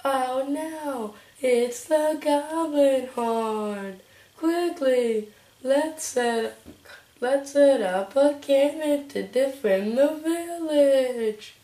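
slow urgent character voice; hammed-up alarmed helper; advisor voice. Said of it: "that's my voice for her".

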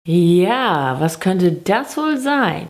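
standard German pronunciation; Hochdeutsch. Shown as a demonstration. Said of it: "natürlich auf Hochdeutsch". (auf Hochdeutsch)

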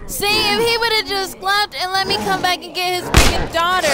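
high-pitched, frustrated gamer rant; exasperated complaint. Real, not acted. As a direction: wail it, really fed up with the game. Player raging at game.

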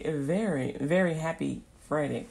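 ordinary talk

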